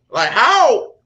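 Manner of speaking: high, loud cry of disbelief; exasperated outburst. Retold or imitated. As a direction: throw up your hands, bro how. Mad at viral fame, retold.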